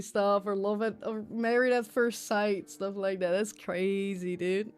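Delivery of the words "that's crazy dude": ordinary talk, nothing marked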